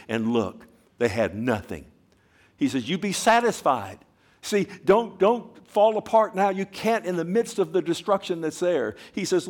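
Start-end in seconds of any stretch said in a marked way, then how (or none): 2.9-8.4 rising, louder pleading; urging preach. Real, not acted. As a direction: press it on them, climbing.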